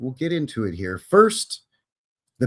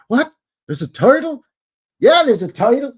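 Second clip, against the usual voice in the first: higher and louder, delighted surprise; surprised; 12 semitones higher, 8 dB louder than usual. Real, not acted. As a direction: react to a surprise guest, pitched way up. Spots a surprise viewer in chat.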